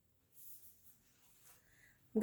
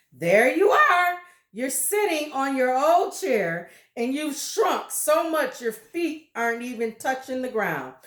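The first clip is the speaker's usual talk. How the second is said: louder, higher shouting husband; shouted; 4 semitones higher, 5 dB louder than usual. Acted. Husband pointing at wife in her chair, loud shout. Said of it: "pointed at her and shouted".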